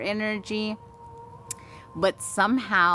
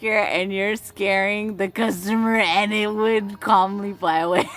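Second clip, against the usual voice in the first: laughing; 4 semitones higher than usual. Real, not acted. Reads comment while laughing.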